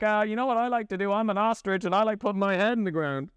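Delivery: lightly voiced head-in-sand type; mock oblivious ostrich. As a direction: parody someone who ignores trouble. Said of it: "It's like".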